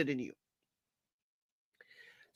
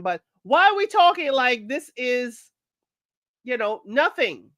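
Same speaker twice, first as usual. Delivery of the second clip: ordinary talk, nothing marked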